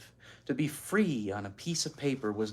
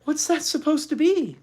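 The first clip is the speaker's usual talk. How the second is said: high puzzled question, not whispered; puzzled girl; 12 semitones higher, 5 dB louder than usual. Acted. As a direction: ask in bafflement. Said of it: "whispered Beezus".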